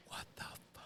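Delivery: whispered what the fuck; whispering. Real, not acted. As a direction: hush it in disbelief. Whispered disbelief at coincidence.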